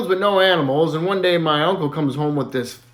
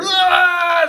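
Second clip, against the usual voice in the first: scream; 12 semitones higher than usual. Retold or imitated. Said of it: "screaming again".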